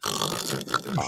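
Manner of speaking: long, low rattling snore; bear-like snore. Retold or imitated. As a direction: imitate snoring like a bear. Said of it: "snoring like a bear".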